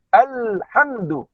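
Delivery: alhamdu slow, with a clean unbounced l; fluent reading. Shown as a demonstration. Teacher shows how fluent readers say it. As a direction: read alhamdu straight through. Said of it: "Dia terus baca alhamdu".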